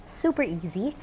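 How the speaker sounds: ordinary delivery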